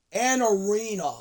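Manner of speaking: ordinary talk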